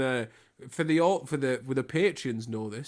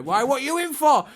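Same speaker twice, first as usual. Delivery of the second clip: high, loud chirpy bloke voice; hammed-up cheery lad outside the prison gate; mock matey ex-con greeting a released prisoner; 11 semitones higher, 6 dB louder than usual. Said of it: "He's like".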